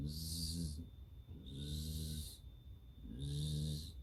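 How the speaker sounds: soft, low snore sounds; snoring. A narrator's reading. Sleeping girl snoring softly in bed. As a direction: breathe out slow sleepy snores. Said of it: "Amy snored".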